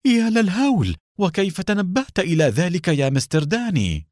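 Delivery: high-pitched, aghast exclamation; horrified. A narrator's reading. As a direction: gasp out your horror, then marvel. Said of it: "شحب وجه حسين وتراقص شاربه الضخم رعبا". (برعب وذهول)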